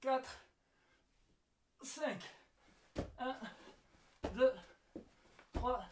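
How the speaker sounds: counting reps mid push-up; doing push ups. Real, not acted. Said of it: "counting reps while doing push ups".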